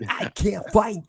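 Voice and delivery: high, off-target celebrity impression; failed Christopher Walken. Botching an impression for co-hosts, high and off-target. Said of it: "that's not Christopher Walken".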